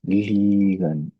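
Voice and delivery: लीगन with a long drawn ee, slow; German ie as long ee. Shown as a demonstration. Teacher to students, demonstrating the ee sound slowly. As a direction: stretch out लीगन clearly, ee sound. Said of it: "इस लफ्ज को हम पढ़ेंगे तो ये होगा लीगन".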